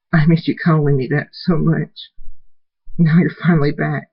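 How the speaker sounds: put-on high-pitched, emotional young daughter; tearful reunion. Tearful daughter welcomes her father back, high emotional voice. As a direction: speak high, moved to tears.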